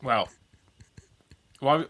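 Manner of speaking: ordinary talk, nothing marked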